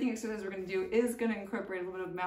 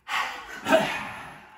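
loud breathy roar with tongue out; forceful open-mouthed exhale; lion's breath exhale; 8 dB louder than usual. Shown as a demonstration. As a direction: push the air out hard like a lion. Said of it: "So I'll do it once".

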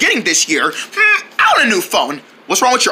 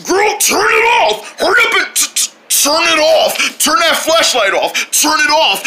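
shouting; 6 semitones higher than usual. Acted. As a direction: yell at her to stop. Yelling at girl to stop flashlight.

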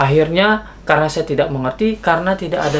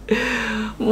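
a quick airy laugh, higher than speech; short breathy laugh; 7 semitones higher, 5 dB quieter than usual. Real, not acted. Vlogger laughing at his own police story, soft airy laugh. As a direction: laugh it off softly.

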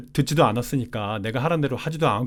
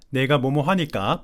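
ordinary delivery

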